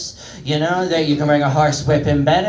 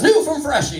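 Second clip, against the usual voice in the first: higher, lightly mocked ad voice; ad interruption; 9 semitones higher than usual. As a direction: cut in like a chirpy commercial. Acts out an ad interrupting a ghost.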